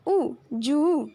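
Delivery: drawn-out ऊ merged into जू; slow blending of ज and ऊ into जू for pupils; blending sounds slowly. Shown as a demonstration. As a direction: stretch ऊ long, then blend it into जू. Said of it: "plus".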